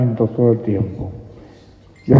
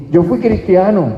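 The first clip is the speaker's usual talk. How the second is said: proud boast; 8 semitones higher, 7 dB louder than usual. Retold or imitated. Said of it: "y tú dices con orgullo".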